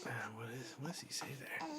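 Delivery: whispered question to herself. Real, not acted. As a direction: breathe it to yourself. Muttered aside while reading.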